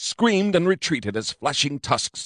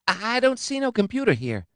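much higher, thin reedy skinny cop; 13 semitones higher than usual. A narrator's reading. Skinny cop Hafifu searching the room, thin and reedy. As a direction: play a thin reedy little policeman. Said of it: "he said in a thin and reedy voice".

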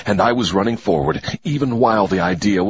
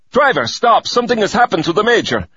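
much higher, louder urgent cry; alarmed shout; 12 semitones higher, 4 dB louder than usual. Acted. Faking panic to the driver, shouting higher. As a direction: yell for the driver in feigned panic.

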